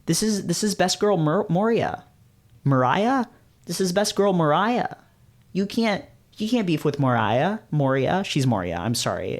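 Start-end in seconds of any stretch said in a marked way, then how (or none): none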